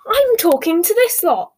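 loud, high-pitched protest; outraged. A narrator's reading. Daughter refuses her mother's summons, loud and outraged. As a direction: protest indignantly at full voice, pitch shooting up. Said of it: "said Ginny, outraged".

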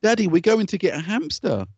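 put-on high-pitched little-boy begging; imitated pleading child. Acting son pleading for hamster.

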